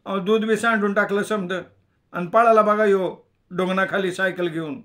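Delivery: high, loud rustic complaint; complaining villager. Acted. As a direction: protest like an aggrieved milkman. Villager complains about the cyclist.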